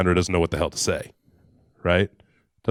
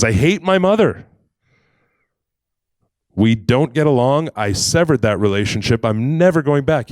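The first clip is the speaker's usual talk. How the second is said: high, loud heated refusal; acted defiant anger; angry outburst; 4 semitones higher, 6 dB louder than usual. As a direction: erupt in indignation, then dig in.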